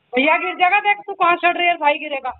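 high, loud, urgent shout; alarmed warning. Real, not acted. Warns child climbing about falling. (घबराकर ऊँची, तेज़ आवाज़ में टोकना)

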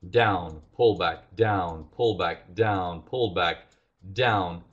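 slow measured step-by-step count; walk them through each move, slowly. Shown as a demonstration. Teacher walking students through the chart, slowly. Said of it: "walk you through very slowly".

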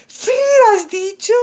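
high, loud shocked "¿Frío has dicho?" with "frío" hit hard; a hammed-up high cry of alarm at "frío"; alarmed maiden. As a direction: gasp out "frío" like a melodrama heroine. Pepa alarmed at his cold.

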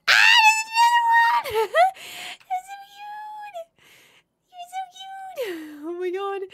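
very high delighted squeal. Real, not acted. Sees baby animal.

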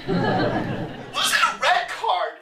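rising frantic questions; frantic guessing. Acted. Escalating desperate guesses.

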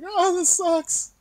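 high, slow, whining; mock whiny. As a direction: drag out the complaint. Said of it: "I'm like".

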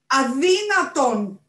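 drawn-out high howl of outrage. A narrator's reading. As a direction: bellow it slowly, high and loud. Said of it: "ούριαξε ο Οράτιος Μπατ".

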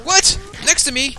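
loud, high startled questions; alarmed disbelief. Pretend. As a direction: react in sudden alarm. Told dragon is beside him.

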